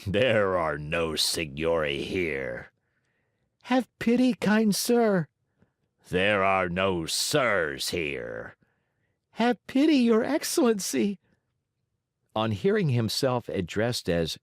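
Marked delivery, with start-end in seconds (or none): 0.1-2.7 gruff giant voice. Acted. Giant refuses. 3.6-5.3 high, pleading little voice; pitiful begging. Acted. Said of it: "in a pitiful voice". 6.1-8.6 gruff giant voice. Acted. Giant refuses. 9.3-11.2 high, pleading little voice; pitiful begging. Acted. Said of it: "in a pitiful voice".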